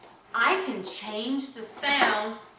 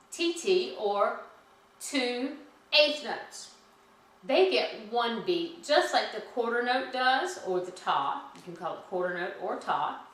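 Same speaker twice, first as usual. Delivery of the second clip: ordinary talk, nothing marked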